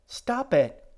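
high, loud cry of stop it; shouted plea. Acted. Girl yelling at Edgar to stop hurting the bush, high loud cry.

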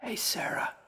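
put-on old woman's voiceless whisper. A narrator's reading. Old woman behind the counter greets Sarah, whispering. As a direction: greet her entirely in a whisper. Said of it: "and whispered".

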